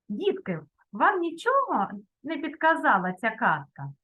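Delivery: ordinary talk, nothing marked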